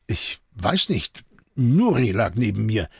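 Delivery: hesitant, halting answer; unsure. A narrator's reading. Antoine explains himself to Jean, mildly unsure. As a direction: answer with mild uncertainty, feeling for words. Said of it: "schien verunsichert".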